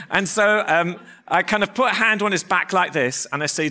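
ordinary talk

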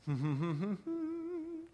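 high laugh. Real, not acted. Laughs after the point.

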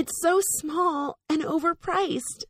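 high, slow gushing sarcastic delight; mock delighted. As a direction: coo over it sarcastically. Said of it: "I was like".

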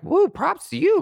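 high, loud sarcastic woo; shrill mock praise, loud and high; mock cheer. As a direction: fake cheering them on. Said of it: "I'm like".

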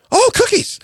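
high, excited exclamation; delighted discovery. Retold or imitated. Hungry patient suddenly spotting cookies in the car, excited. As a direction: burst out in a high, eager pitch.

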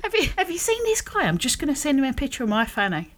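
high mocking lilt; mock absurd offer. Imagining a woman doing the same, lightly voiced mock offer.

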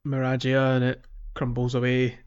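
usual speaking voice

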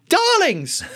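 very high, laughing luvvie cry of darlings; theatrical darlings. Acted. The self-styled performer mocks himself as a high, laughing luvvie. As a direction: trill it like a camp stage star. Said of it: "being a performer that I am".

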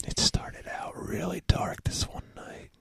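hushed campfire-story whisper; spooky whisper. Pretend. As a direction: whisper it like a ghost story by the fire.

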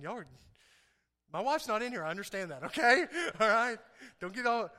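ordinary talk

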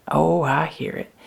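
ordinary talk

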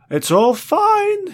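a grown man's fragile falsetto, drawn out; high slow falsetto murmur; near falsetto murmur. Acted. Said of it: "he murmured in near falsetto".

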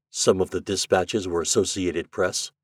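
plain reading voice

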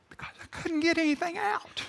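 high, soft, strangled words; squeezed high and small, mock speechless; choked-up voice. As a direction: imitate words failing to come out. Acting out being unable to speak.

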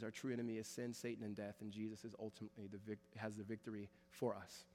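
soft, reverent opening prayer. Real, not acted. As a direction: pray quietly and humbly over the church.

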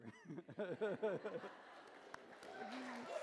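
short nervous laugh. Real, not acted. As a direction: laugh off the nerves. Laugh after first sermon remark.

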